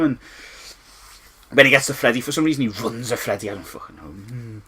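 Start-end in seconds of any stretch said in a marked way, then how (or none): none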